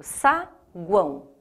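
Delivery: "saguão" slowly as sa, guão; split into syllables. Shown as a demonstration. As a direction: pause inside "saguão" between sa and guão. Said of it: "Eu separo em sílaba".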